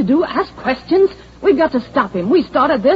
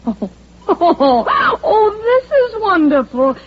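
laughing with relief; 7 semitones higher, much slower than usual. Acted. Bursts laughing at the mix-up.

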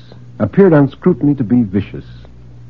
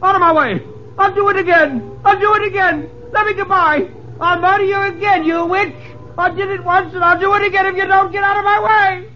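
very high, frantic screamed confession; crazed, panicked man raving at a ghost; hysterical shouting. Acted. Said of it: "He became pitiably hysterical".

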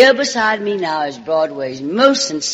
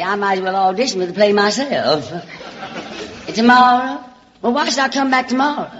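Southern belle voice; 4 semitones higher than usual. Acted. Alabama girl sweet-talks the producer's secretary, in a Southern belle voice. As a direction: play the small-town Alabama charmer calling everyone sugar and darling.

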